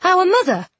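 very high, astonished question; stunned, high-pitched disbelief; shocked. A narrator's reading. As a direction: blurt it out in shock.

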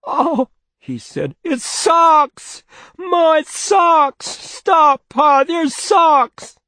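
put-on high, loud, anguished child's cry. A narrator's reading. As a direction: cry out in horror like a boy. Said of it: "Bruce's cry".

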